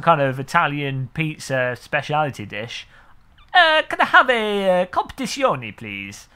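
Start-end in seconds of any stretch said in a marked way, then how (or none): 3.5-6.3 high, raised voice sinking on the last word; acted restaurant customer. Tourist ordering an Italian dish from a waiter, hammed-up customer voice. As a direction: order like a showy diner, drawn-out start.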